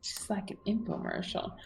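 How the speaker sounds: unvoiced whispered aside. Real, not acted. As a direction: breathe it under the others' talk.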